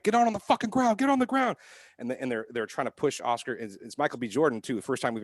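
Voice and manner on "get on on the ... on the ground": much higher mock cop yell. Film cops ordering men to the ground, mock yell. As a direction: bark it like a cop in a tense arrest. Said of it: "they're like".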